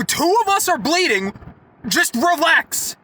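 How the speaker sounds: high-pitched, insistent retold scolding; exasperated telling-off. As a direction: order her to calm down, stretching the last words. Retelling telling off the customer.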